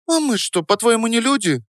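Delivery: put-on very high-pitched girlish protest; high indignant squeal of a question. A narrator's reading. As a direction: challenge him, affronted, voice shooting up. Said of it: "возмутилась Дарья".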